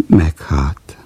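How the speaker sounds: whispered grudging reply. Acted. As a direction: breathe it out. Grumpy character answers curtly.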